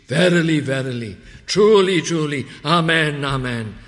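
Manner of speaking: loud, high, rising preacher's cry; full-force preaching. Acted. Preacher urging the congregation to stop and listen, loud preaching. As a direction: thunder it from the pulpit.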